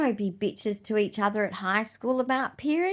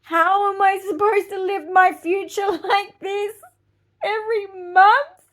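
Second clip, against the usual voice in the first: higher, louder, whiny put-on voice as her teenage self; despairing teen self; 9 semitones higher, 6 dB louder than usual. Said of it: "I'm like".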